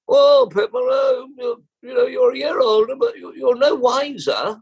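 high-pitched mock aristocrat voice. Aristocrat turning over the fallen jockey, mock aristocratic voice. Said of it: "as aristocracy would do, and said".

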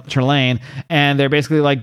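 ordinary talk, nothing marked

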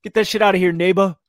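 high, loud trash talk; mock trash talk. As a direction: swat the shot away and bark at him. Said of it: "talking trash".

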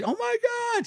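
very high, breathless-surprise imitation; mock shocked squeal. Wife startled by his return, high mock squeal.

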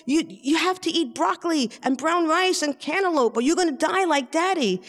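high-pitched insistent warning, retold; mild acted exasperation. Daughter warning mom about her diet, mildly exasperated. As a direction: lay down the law to mom, half pleading.

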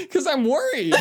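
high, breathless laughter; laughing. Real, not acted. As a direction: laugh through the words. Laughing hard at the joke.

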